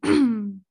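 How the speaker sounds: sudden loud throat-clearing cough; choking cough. Real, not acted. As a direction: hack to clear a choked throat. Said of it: "Perdón, casi me ahogo".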